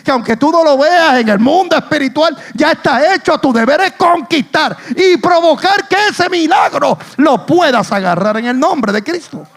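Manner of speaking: loud, high, building sermon climax; full-force preaching. Real, not acted. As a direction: exhort the congregation, driving up to a shouted peak. (predicación a toda voz, en ascenso)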